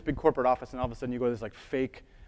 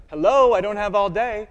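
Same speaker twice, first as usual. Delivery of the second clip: impatient, higher and loud; Miss Dewey impatient; 7 semitones higher, 10 dB louder than usual. Retold or imitated. Search librarian scolding an idle user, impatient loud call. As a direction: tap the glass and demand attention, fed up. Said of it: "Miss Dewey gets impatient".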